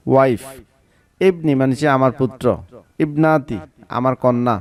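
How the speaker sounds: usual speaking voice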